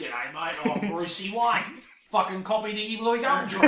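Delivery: a mock Australian accent, hammed up with a laugh; lightly put-on Aussie twang, like a jokey Australian Batman. Friend mocking an Australian Batman, mild Australian accent. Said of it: "There's a very Australian Batman".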